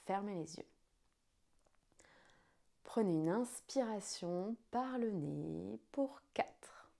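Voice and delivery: slow, hushed guided breathing count. Acted.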